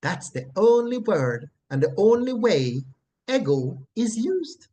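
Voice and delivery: ordinary delivery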